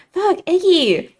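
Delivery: high, loud, drawn-out startled yelp. Acted. Friend jumping back at seeing Iggy, startled. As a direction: jump out of your skin and cry out.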